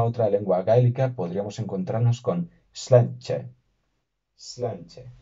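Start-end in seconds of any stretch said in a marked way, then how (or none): 2.7-5.1 sláinte said roughly as "slan-che"; sláinte with a ch sound before the final e; tentative Irish pronunciation. Shown as a demonstration. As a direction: try sláinte slowly, as a learner of Irish. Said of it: "disculpo mi pronunciación porque el gaélico todavía no lo".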